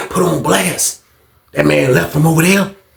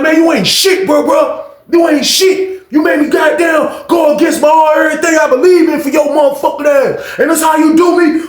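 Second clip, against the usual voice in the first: high-pitched wounded yelling; hammed-up hurt and fury; acted betrayed tirade; 13 semitones higher, 4 dB louder than usual. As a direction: rant at a friend who betrayed you.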